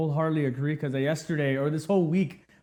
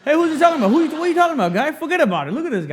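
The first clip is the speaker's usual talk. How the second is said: loud, high-pitched, hammed-up New Yorker accent; mock New York accent; 11 semitones higher, 6 dB louder, much faster than usual. New Yorkers heckling a confused Texan, mock New York accent. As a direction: pile on like comic, incredulous New Yorkers, accent exaggerated. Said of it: "not all New Yorkers sound like that".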